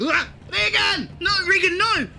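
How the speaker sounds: frantic loud cries of alarm; panicked crying out. Acted. Friend watching Regan grabbed, panicked yelling. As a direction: yell his name in panic.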